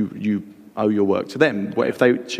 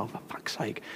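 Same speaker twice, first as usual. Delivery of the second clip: very soft whispered grumble; hushed, fed-up mutter to yourself; exasperated aside; 17 dB quieter than usual. Retold or imitated. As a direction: curse under your breath.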